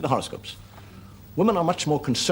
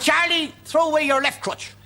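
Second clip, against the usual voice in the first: high, lilting Pope calling a name; mock Pope calling out, pitched high; 3 dB louder, slower than usual. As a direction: play the Pope summoning Charlie. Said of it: "he didn't have an Irish accent".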